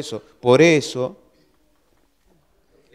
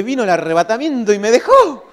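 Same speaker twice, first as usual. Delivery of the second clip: loud, high comic dismay; mock panic; 9 semitones higher, 9 dB louder than usual. Preacher retelling his fear of missing the rapture, mock-panicked cry. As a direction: act out the dread of being left behind. Acting out panic at being left.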